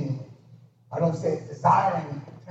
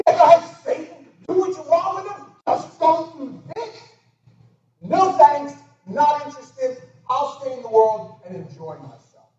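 sarcastic dismissal; 9 semitones higher, 6 dB louder than usual. Retold or imitated. Preacher voices sarcastic unbeliever.

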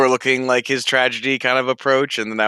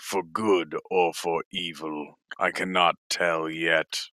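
low, portentous ruler's voice; softer, weighty imperial pronouncement; emperor; 5 semitones lower, 5 dB quieter than usual. Acted. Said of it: "but you're the emperor".

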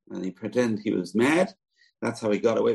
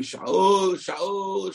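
calling out; 9 semitones higher than usual. Acted. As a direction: call his name across the valley. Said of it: "he starts screaming".

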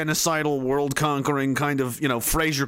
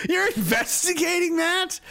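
high shouting disbelief; incredulous shout; 12 semitones higher than usual. Pretend. Citizen yelling at the police, high incredulous shout. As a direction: yell it in disbelief.

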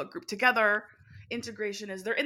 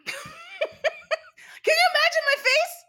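very high, loud and drawn out through laughter; laughing incredulously; 11 dB louder, much slower than usual. Real, not acted. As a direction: squeal it slowly, cracking up. Laughs at own remembered reaction.

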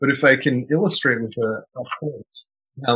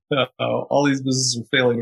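ordinary talk, nothing marked

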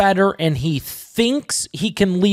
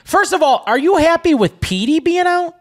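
teasing challenge; 10 semitones higher, 4 dB louder than usual. Real, not acted. Host teasingly grilling cohost.

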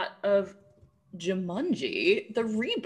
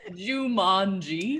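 laughing, slow Jumanji; mock slow Jumanji; much slower than usual. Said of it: "she says it so slow".

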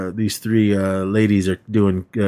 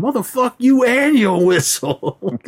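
high, drawn-out mock outrage; acted angry retort; 13 semitones higher, slower than usual. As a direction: fling it out high and slow, playing indignant. Acted quarrel with carolers.

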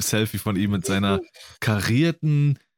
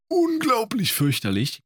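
exaggerated disgust; 13 semitones higher than usual. Real, not acted. Disgust at rhinestone kids' clothes.

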